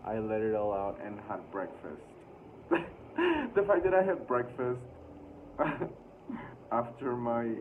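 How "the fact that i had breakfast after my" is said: high laugh breaking through words. Real, not acted. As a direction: giggle at the absurdity. Laughing at her own diary.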